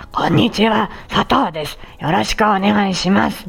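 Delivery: rough, gravelly rasp on every word; raspy voice. Shown as a demonstration. Speech therapist demonstrating a rough, raspy voice to listeners. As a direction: grind the voice like a rough throat. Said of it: "みたいにガラガラ声になる".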